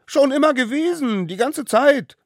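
put-on very high, loud child's retort; defiant yelling. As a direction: insist at the top of your little voice. Smallest one quarrels, insists. (trotzig gekreischt)

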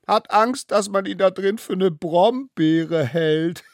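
high, giggly punchline breaking into cackles; gleeful mocking laughter; cackling with laughter. A narrator's reading. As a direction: barely hold in the laugh, then cackle. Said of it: "begackeierten sich aus vollen Hälsen".

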